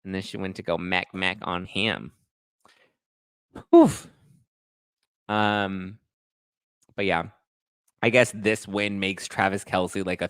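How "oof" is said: high oof. Real, not acted. Reaction sound.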